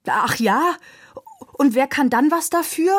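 high, irritated retort; exasperated groan. A narrator's reading. Coachman answers the boy defending Leo, exasperated. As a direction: throw the question back, fed up. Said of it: "stöhnte der Kutscher".

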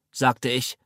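plain reading voice

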